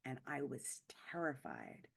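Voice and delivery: hushed, breathless confession of fear; dramatic whisper. Shown as a demonstration. Speaker telling the audience about her stalker, whispering. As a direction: drop to a whisper on terrified.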